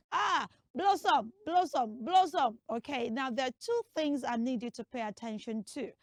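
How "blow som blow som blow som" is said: "Blossom" with long o, BLOW-som; common mispronunciation. Shown as a demonstration. Teacher mimicking the popular way, mispronounced "Blossom". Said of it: "not rightly pronounced as Blossom".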